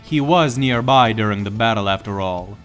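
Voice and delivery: usual speaking voice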